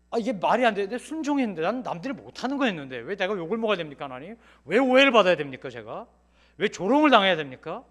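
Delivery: loud, aggrieved cry of why me; indignant protest to God. Retold or imitated. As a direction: hurl the questions upward, wounded and angry. Complaining to God about slander.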